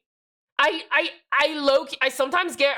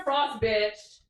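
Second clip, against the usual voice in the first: usual speaking voice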